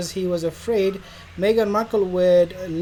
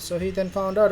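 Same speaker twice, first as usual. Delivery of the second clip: ordinary talk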